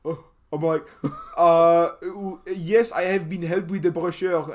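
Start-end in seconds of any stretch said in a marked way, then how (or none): 1.4-4.4 hammed-up French accent on English words; halting, badly faked French accent; fake French accent. Student bluffing to the language-stand guy, fake French accent. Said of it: "in a French accent".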